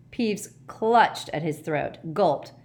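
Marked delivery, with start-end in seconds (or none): none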